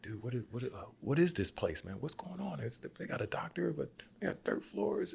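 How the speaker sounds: high, soft confused asking; whisper-ish confusion to the other Americans; confused hushed question. Retold or imitated. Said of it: "I was like".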